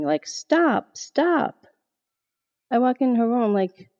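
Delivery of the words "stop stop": put-on higher, childlike cry of stop, only mildly yelled; imitating child yelling. Mother retelling daughter yelling at voice, mild mock cry. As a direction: mimic a scared little girl pushing someone away.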